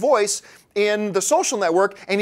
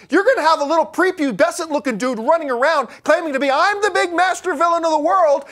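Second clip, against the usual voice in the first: rising, louder mock villain boast; sarcastic build to a higher, louder sneer; 7 semitones higher, 3 dB louder than usual. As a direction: scoff at the puny villain claim. Mocking villain boast, high and loud.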